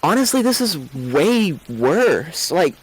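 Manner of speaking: in character, pitch lifted, stressing how bad it is; an anxious cook itching to work; restless, anxious character. Player voices a restless character.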